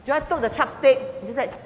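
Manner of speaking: ordinary talk, nothing marked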